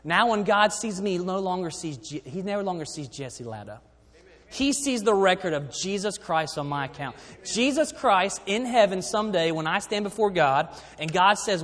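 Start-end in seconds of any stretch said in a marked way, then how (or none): none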